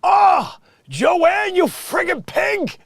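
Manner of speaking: high, loud exasperated yell, slowing on pig; imitating Tim yelling, high and loud; mock yell as Tim. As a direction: bellow it at Joanne like the guy in the background. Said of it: "He's going".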